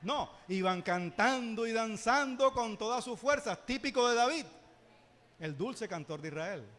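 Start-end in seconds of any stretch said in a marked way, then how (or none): 0.0-4.4 loud and slow, building fervour; slow down and let it ring out; preaching at full force. Acted. Pastor painting David's joyful worship: loud, slow preaching.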